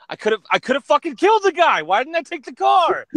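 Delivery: loud, very high exasperated yell; robber's hammed-up frustrated shout; acted scream. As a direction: scream it as the realization hits. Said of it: "So he just screams".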